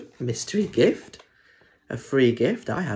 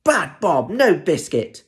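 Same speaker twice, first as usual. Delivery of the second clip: higher, loud scolding; angry mum; 7 semitones higher, 7 dB louder than usual. Acted. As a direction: tell the dog off hard.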